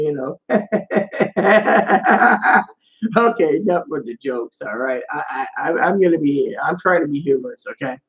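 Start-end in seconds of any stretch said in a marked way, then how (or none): 0.4-2.6 airy laugh breaking out; real amused chuckle; tickled laugh. Real, not acted. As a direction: laugh at your own bit. Laughs after the Skyrim joke.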